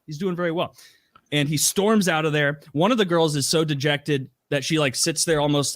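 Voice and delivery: ordinary talk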